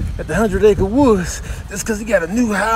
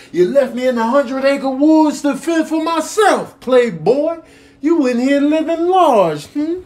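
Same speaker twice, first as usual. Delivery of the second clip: higher, louder angry bear; higher, louder accusing bear; loud scolding; 8 semitones higher, 7 dB louder than usual. Acted. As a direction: call him out loudly, higher. Bear scolding Christopher Robin loudly.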